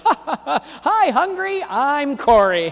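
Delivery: high, laughing joker voice teasing a pun; a friend laughing at their own bad dad joke; mocking laugh. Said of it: "kind of laughs and goes".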